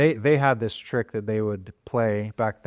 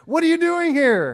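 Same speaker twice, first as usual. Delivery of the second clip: overjoyed disbelief; 5 dB louder than usual. Retold or imitated. Daughters greeting long-missed friend, overjoyed. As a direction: gush with happy disbelief. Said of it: "my girls are saying".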